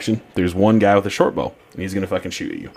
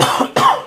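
sudden dry cough; coughing on dust; 6 dB louder than usual. Pretend. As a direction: cough as if dust caught in throat. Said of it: "the dust is getting in my throat".

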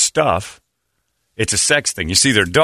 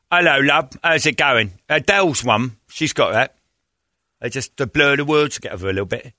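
higher-pitched Cockney chimney sweep; 4 semitones higher than usual. Acted. Host showing how chimney sweeps talk, mock Cockney accent. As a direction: put on a chatty Cockney accent. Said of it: "chimney sweeps are Cockney".

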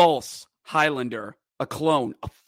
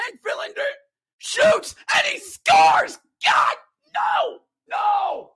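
much higher, shouting goal call; frantic play-by-play; 10 semitones higher than usual. Real, not acted. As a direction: scream the overtime goal in disbelief.

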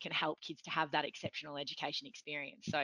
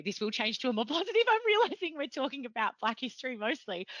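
higher, louder, laughing as you speak; laughing apology; 7 semitones higher, 5 dB louder than usual. Real, not acted. Host reassuring guest about hating history, laughing apology. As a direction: laugh through an embarrassed reassurance.

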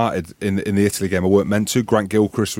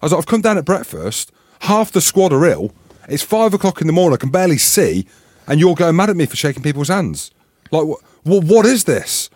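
retold heated protest; indignant retort; 7 semitones higher than usual. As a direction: push back hard at the coach. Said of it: "I'm like".

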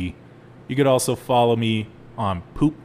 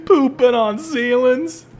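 much higher, louder and slow; incredulous; 12 semitones higher, 5 dB louder, slower than usual. Real, not acted. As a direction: repeat it in shocked disbelief. Disbelieving recap of the story.

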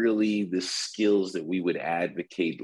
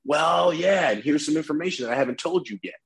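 counterpart's reply; 8 semitones higher than usual. Retold or imitated. Counterpart's reply voiced in example.

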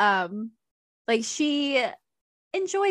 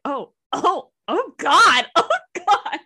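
hammed-up gasping dismay, rising higher; shocked reader; 6 semitones higher, 5 dB louder, slower than usual. Joe reads the racy part, mock-shocked. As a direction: imitate his mounting shock at the page. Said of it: "his reactions are the best".

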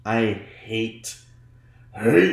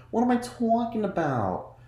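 "What am I talking about?" jumped much higher, falling at the end; mock confused; 11 semitones higher than usual. Shown as a demonstration. Correcting herself about the show, high mock confusion.

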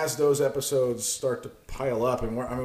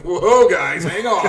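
loud, higher mock protest; imagined objection; 3 semitones higher, 8 dB louder, slower than usual. Imagined listeners objecting to the German jokes, mock protest. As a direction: pretend to halt the fun. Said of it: "nobody's probably out there just being like".

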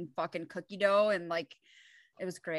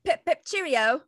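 higher, louder mock British cheerio; British sign-off; 7 semitones higher, 5 dB louder, slower than usual. Cohost joins the sign-off in mock British. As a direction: chirp a posh goodbye.

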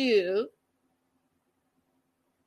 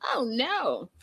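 higher, put-on sassy disbelief; mock outrage at the rude man; indignant; 5 semitones higher, 3 dB quieter than usual. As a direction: scold him like an outraged friend. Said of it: "I was like".

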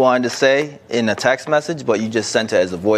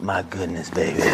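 Romeo voice; 7 semitones lower, 8 dB quieter than usual. Pretend. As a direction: lower the voice and woo her. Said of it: "this is Romeo right here".

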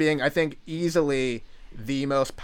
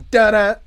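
loud, high little da-da flourish; sung ending; 7 semitones higher, 9 dB louder than usual. Shown as a demonstration. Host demonstrates his song ending with a loud high flourish. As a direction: belt a two-note ending. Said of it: "Oh, I go".

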